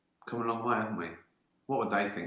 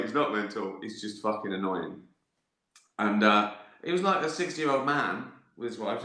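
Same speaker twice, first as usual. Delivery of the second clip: usual speaking voice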